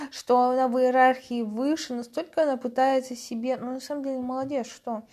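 ordinary talk, nothing marked

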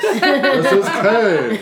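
loud, slow news anchor voice. Pretend. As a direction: read it like a bad TV anchor. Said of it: "horrible news anchor voice".

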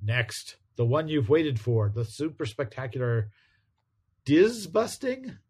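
hyped-up announcer read; comic announcer. Acted. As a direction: sell it like a comic blurb. Reading next-issue blurb aloud.

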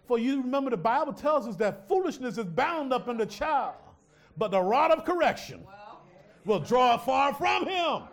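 rising from strong to shouting; preaching build to shout. Real, not acted. Preacher to congregation, building to a full shout.

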